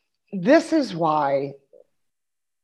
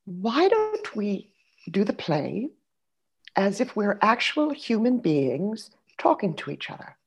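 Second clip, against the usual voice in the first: soft, very slow, deliberate actress voice; Rosemary Harris; 4 semitones higher, 3 dB quieter, slower than usual. Retold or imitated. Veteran actress proposing a truer way to play it, slow and deliberate. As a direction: draw out the polite suggestion, pitched up and gentle. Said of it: "She said".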